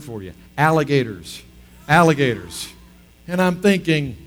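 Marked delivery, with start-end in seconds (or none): none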